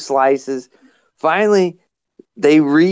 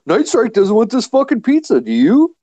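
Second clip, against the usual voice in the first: higher, teasing offer; mock offering; 8 semitones higher than usual. Prankster offering Gizzard the pizza, higher teasing voice. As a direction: dangle the pizza in front of him. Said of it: "He's like".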